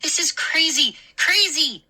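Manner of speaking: high, rising exasperation; exasperated. A narrator's reading. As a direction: lose it at the absurdity. Mindy in disbelief.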